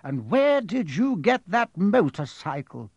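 put-on relieved old wizard. A narrator's reading. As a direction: sigh it out with relief. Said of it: "sounding relieved".

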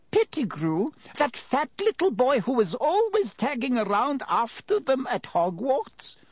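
high-pitched woman's voice, incredulous question; landlady character. A narrator's reading. Landlady asking about Pettigrew, high surprised voice.

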